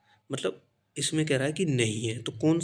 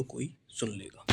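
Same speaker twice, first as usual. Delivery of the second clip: much softer, almost under the breath; hushed; 2 semitones lower, 10 dB quieter than usual. Shown as a demonstration. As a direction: say it so nobody nearby hears. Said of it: "धीरे से बोलो".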